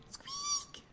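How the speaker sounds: tiny high-pitched squeak, soft; high mouse squeak. Acted. Librarian showing kids how a mouse squeaks, high tiny squeak. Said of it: "What about this?".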